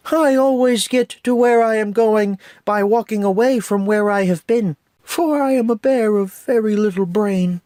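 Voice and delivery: high, gentle, dreamy Pooh, well above his own; Pooh Bear voice. Retold or imitated. Reviewer quoting Pooh's wisdom, high gentle Pooh voice.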